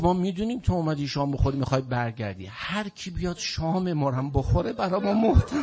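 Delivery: fervent sermon build as the Imam welcomes the guest; impassioned preaching. Acted. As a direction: preach it, rising toward a wail. Imam's reply, preached rising.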